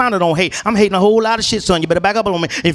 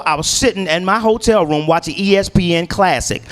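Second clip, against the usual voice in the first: normal talking voice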